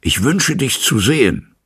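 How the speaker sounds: loud call through the door. A narrator's reading. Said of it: "rief Utterson mit lauter Stimme". (laut durch die Tür gerufen)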